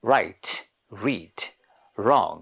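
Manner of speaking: "Write" "read" "wrong" with the R said clearly at the start; British R sounds. Shown as a demonstration. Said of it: "Like, they would say". (শুরুতে র স্পষ্ট করে "Write, read, wrong")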